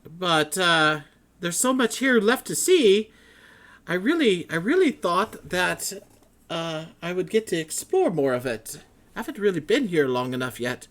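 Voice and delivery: high, loud, flustered scholar voice; excited scholar. Acted. Matrius keeps talking.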